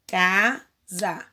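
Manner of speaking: "casa" broken into ca-sa; syllable split. Shown as a demonstration. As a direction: mark each syllable of "casa". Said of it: "Na casa".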